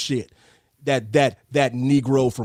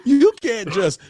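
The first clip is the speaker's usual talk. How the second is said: much higher, slow laughing "you can't"; laughing protest; 10 semitones higher, much slower than usual. Retold or imitated. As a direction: protest while cracking up.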